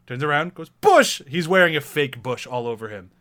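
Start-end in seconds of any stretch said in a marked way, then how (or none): 0.7-1.2 high, loud mock announcement; proud exclamation. Co-host acting out Bushman's entrance, proud high shout. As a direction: call it out high with showy pride. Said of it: "he's so proud of it".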